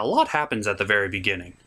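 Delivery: normal talking voice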